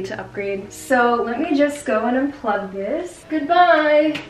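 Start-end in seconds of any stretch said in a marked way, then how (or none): 3.3-4.2 dramatic, higher-pitched farewell. Real, not acted. Vlogger parting with the old iMac, dramatic. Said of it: "That was a little dramatic".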